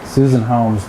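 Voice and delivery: usual speaking voice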